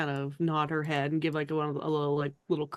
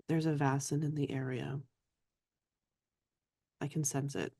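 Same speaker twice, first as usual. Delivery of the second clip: Signy, very quiet; 6 dB quieter than usual. Acted. Signy leans in close to warn her companions, very quietly. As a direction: confide it quietly, leaning in close. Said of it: "she says very quietly under her breath".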